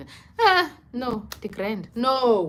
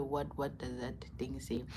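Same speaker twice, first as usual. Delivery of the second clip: whisper; 6 semitones lower, 12 dB quieter than usual. Real, not acted. Aside, voice drops.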